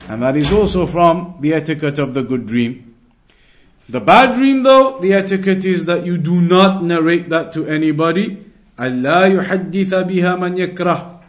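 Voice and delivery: normal talking voice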